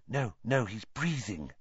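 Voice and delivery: soft, hushed reassurance; near-whisper, gently correcting. A narrator's reading. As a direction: keep it very quiet near a sleeper. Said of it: "whispered Hermione".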